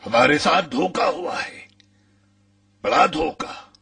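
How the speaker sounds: high-pitched cry of betrayal; alarmed outcry. Acted. Telling comrades they have been deceived, high alarmed outcry.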